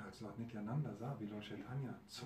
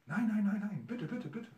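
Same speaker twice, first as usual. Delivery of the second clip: frantic pleading; 11 semitones higher, 10 dB louder than usual. Acted. Beaten preacher begs the men.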